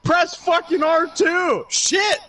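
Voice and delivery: high, slow shout at the player; hammed-up angry dad yelling; dad's mock yell. Dad yelling at son's friend to press button, shouting. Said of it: "my dad's head pops in".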